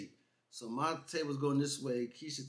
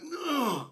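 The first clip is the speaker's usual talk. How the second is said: high, loud mock groans; pained groans; 11 semitones higher, 5 dB louder than usual. Man retelling massage to co-host, mock pained groans. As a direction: groan as if being hurt, hammed up. Acts out pain during massage.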